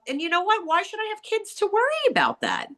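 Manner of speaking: ordinary talk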